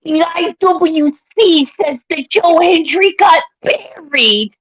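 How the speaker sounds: high, slow whiny mimicry; whiny mock voice.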